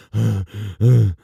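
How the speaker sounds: mock out-of-breath gasp, loud; one big gasping breath, hammed up; imitating a gasp. Streamer mimics the wrestler gasping for air. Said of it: "He's like".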